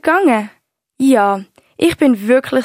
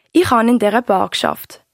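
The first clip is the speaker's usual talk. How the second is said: ordinary talk, nothing marked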